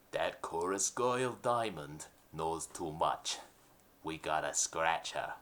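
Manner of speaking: low, slow gangster boss voice; mafia boss. Acted. Mob boss ordering his henchman, low and slow. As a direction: growl it like a nineteen thirties mob boss.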